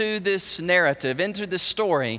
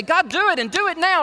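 impatient demand to God; 9 semitones higher, 3 dB louder than usual. Retold or imitated.

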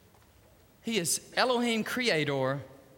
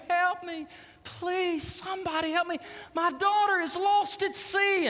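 desperate scream for help; 11 semitones higher than usual. Retold or imitated. Father on the shore begging strangers to save his daughter, screaming. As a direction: shout it as if your child is lost at sea. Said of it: "he's screaming".

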